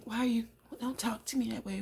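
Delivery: high-pitched mock protest; offended retort. Wife objecting to Raymond's tone, offended.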